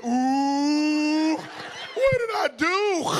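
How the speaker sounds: long rising ooh, then high, slow question; scandalized tattletale. Retold or imitated. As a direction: imitate a shocked kid drawing out every word. Acts out relative's scandalized reaction.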